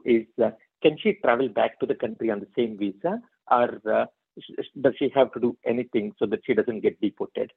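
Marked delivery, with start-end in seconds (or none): none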